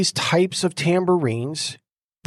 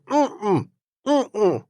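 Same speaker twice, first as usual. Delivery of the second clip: closed-mouth straining hum, pitched up; 4 semitones higher than usual. Retold or imitated. Paralyzed man strains to speak through a sealed mouth with a humming grunt. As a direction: hum through a clamped jaw, trying to speak. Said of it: "I'm going".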